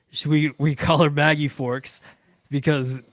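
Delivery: ordinary talk, nothing marked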